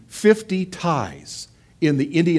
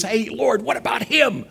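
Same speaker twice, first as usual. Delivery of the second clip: higher, whiny complaint to the Lord; jealous Peter; 8 semitones higher than usual. Acted. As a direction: needle the Lord like a jealous friend. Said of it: "looks over his shoulder and says".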